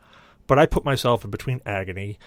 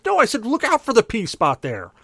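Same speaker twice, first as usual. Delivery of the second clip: high, raised retold yell; high-pitched mock outrage; exasperated correction; 11 semitones higher, 4 dB louder than usual. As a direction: imitate an exasperated brother correcting someone. Brother corrects him in exasperation.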